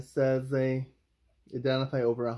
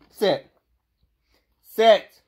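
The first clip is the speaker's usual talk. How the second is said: loud, high sharp command; firm dog commands; 8 semitones higher, 11 dB louder than usual. Real, not acted. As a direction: order the dog to sit.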